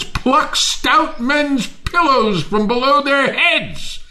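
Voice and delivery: high, loud, feverish rant with bursts of laughter; manic raving. Acted. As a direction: rave over the gold like a madman. Timon finds gold, raves wildly.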